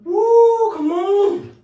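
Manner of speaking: high, loud yelp of shock; cold shock yelp. Real, not acted. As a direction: cry out at the icy water. Cold water plunge.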